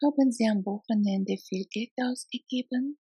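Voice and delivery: ordinary talk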